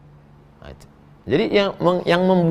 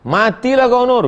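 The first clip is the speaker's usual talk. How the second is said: mock scolding; 7 semitones higher, 4 dB louder than usual.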